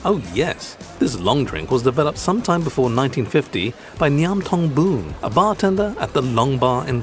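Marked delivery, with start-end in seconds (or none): none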